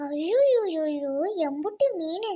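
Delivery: gasp, then a slow, drawn-out swooping cry; amazed wail. Acted. Woman finding a huge heap of fish, amazed. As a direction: marvel with a long, stretched ayyo.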